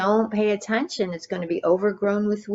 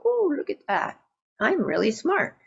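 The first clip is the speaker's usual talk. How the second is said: much higher, mock-proud voice, pleased with himself; smug self-admiration; 7 semitones higher than usual. Monk admiring his own bookcase, smug and higher. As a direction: play up the monk's vanity, lightly mocking. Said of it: "look at the bookcase and go".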